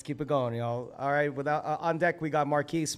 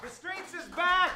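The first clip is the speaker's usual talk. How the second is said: high-pitched hype call; excited hype; slower than usual. Real, not acted. Host hyping up the last performer, excited hype. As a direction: echo it back as a high cheer.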